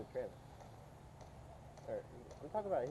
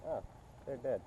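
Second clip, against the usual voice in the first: ordinary talk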